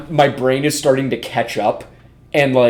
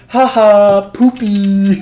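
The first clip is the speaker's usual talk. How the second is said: high, loud meme voice imitation; monkey video imitation; 8 semitones higher, 5 dB louder, much slower than usual. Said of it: "like the monkey video where it's like".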